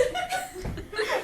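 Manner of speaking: high squeal of laughter. Real, not acted. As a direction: let out a high giggle. Squealing laugh mid-story.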